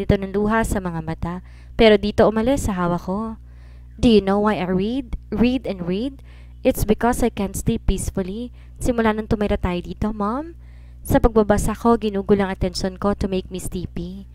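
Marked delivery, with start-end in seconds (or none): none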